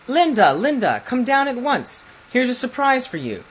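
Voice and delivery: calls up the stairs high and loud; excited calling. A narrator's reading. The ambassador calls Linda downstairs, high excited shout. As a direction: shout upstairs for her in amazement. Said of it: "and crying".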